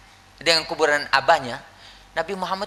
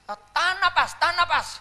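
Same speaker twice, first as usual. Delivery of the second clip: doctor coaching; 12 semitones higher than usual. Acted. Doctor coaching a birth, acted.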